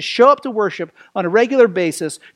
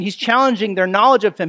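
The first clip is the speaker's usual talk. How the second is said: normal talking voice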